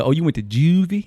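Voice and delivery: lightly put-on nosy interviewer; imitated Baltimore interviewer. Retelling the Baltimore video to a friend, imitated questioning. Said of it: "He said".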